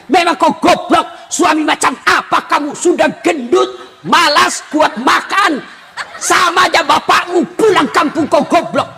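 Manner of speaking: acted tirade, spitting out each insult; hammed-up angry wife berating her husband; cursing wife. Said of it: "tidak boleh maki-maki".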